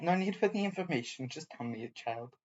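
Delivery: low, quick, creepy murmur; sinister hush. Acted. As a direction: con him softly, quickly. Sinister data-grabbing character.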